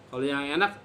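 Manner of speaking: ordinary delivery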